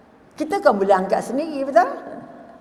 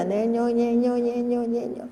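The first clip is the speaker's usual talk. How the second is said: murmured zikir imitation; slower than usual.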